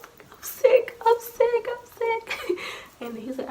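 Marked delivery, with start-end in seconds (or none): 0.3-2.6 very high squeaky mock sobbing; retold bawling. Child bawling to dad at the door, mock sobbing. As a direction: wail it in a tiny crying voice. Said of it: "I was bawling".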